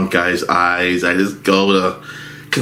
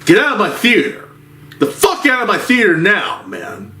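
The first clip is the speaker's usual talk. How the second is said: mock yell; 11 semitones higher than usual. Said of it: "the bartender was like".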